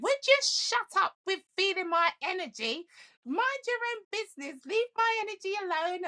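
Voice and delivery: high, loud mock scolding mother; irritated mom. Mom telling daughter to stop reading her energy, high mock scolding. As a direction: imitate mom snapping at her nosy daughter.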